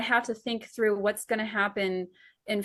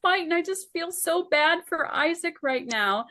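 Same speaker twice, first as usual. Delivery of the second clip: very high, fast, gushing sympathy; mock heartbroken; 9 semitones higher than usual. Writer to husband about her characters, high gushing mock sorrow. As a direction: pour it out like a friend just got hurt.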